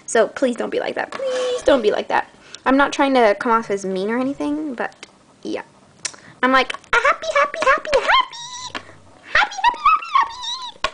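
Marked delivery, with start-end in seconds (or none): 1.1-2.3 much higher whiny please. Shown as a demonstration. Asking viewers to be kind, higher and pleading. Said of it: "repeated higher". 6.4-10.9 climbing far higher with each happy; bubbly happy. Acted. Describing herself at school, squeaky and bubbly.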